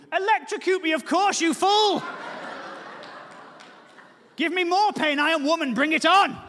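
high, loud, furious wife; mock labour yell. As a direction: bellow it through the contractions. Wife in labour yelling back.

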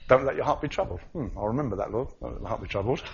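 soft offhand brushing-off; dismissive. Pretend. Believer filing the verse away, casually soft. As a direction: toss it aside lightly. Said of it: "Put it in a little box".

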